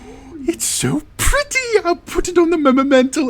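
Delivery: very high-pitched gushing falsetto; delighted daughter. Acted. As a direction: coo over a gift in a high character voice. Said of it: "And she's like".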